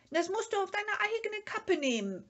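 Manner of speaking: high, loud, fast warning shout. A narrator's reading. Sniff yelling a warning as Mumin walks into the sea, high and loud. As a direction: yell after him as he wades in. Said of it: "schrie das Schnüfferl".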